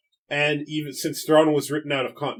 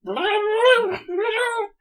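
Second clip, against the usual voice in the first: squealing laugh, pitch way up; high laughing squeal; 5 dB louder than usual. Real, not acted. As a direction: let out a giddy high laugh. Overwhelmed laughing cry.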